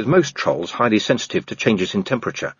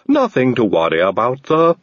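cheerful Igor; 4 semitones higher, 4 dB louder than usual. A narrator's reading. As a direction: reassure them brightly, in no hurry. Igor voiced in the story.

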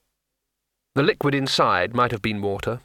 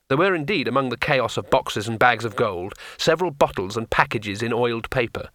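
ordinary delivery

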